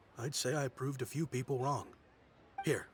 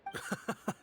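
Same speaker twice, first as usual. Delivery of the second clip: breathy chuckle; 9 semitones higher than usual. Acted. Amused at being called rich.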